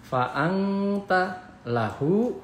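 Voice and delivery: "Fa'angta lahu" chanted slowly with a held ng; ikhfa recitation. Shown as a demonstration. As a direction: hum the blurred n, then "lahu". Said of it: "N dibaca eng jadi samar".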